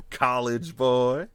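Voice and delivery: hammed folksy jab; gentleman bumpkin voice. The old days, taunting someone in the bumpkin voice. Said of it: "the gentleman bumpkin voice".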